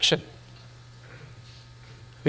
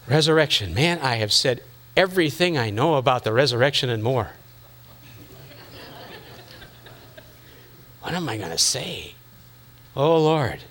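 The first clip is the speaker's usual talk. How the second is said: deflated, out of ideas, trailing low; flat, spent sigh; 5 semitones lower, 4 dB quieter than usual. Retold or imitated. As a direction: sound tapped out and weary. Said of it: "And you just feel flat".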